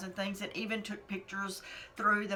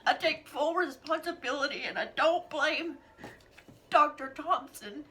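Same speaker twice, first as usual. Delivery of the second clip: crying; 7 semitones higher than usual. Real, not acted. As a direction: own it through tears. Tearful, slow confession.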